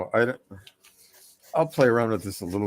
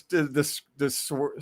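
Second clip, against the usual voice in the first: ordinary talk, nothing marked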